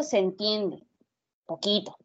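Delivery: usual speaking voice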